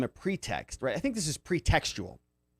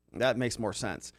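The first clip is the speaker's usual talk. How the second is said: usual speaking voice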